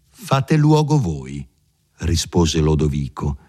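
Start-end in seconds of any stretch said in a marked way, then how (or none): 0.1-1.5 a slow, high defiant echo of the order; higher, slow challenging reply; defiant retort. A narrator's reading. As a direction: throw the words back at him, slowly and pointedly. Lodovico throws the order back.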